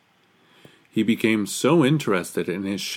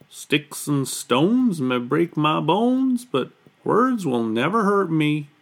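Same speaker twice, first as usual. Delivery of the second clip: high, slow little Moose voice; Moose consoling himself; 6 semitones higher than usual. A narrator's reading. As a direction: recite it slowly as a small, stoic Moose.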